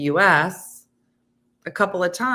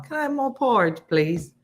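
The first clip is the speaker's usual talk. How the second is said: put-on high, pleading English orphan accent; Oliver Twist English accent; 7 semitones higher than usual. Host doing her Oliver English accent for chat. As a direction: beg for porridge in an English accent. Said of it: "either from Oliver or Sherlock Holmes".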